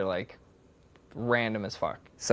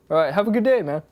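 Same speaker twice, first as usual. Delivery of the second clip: higher, louder casual farewell; retold loud send-off; 7 semitones higher, 6 dB louder, faster than usual. Store owner sending customer off, loud and easygoing. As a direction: call out a breezy goodbye in imitation.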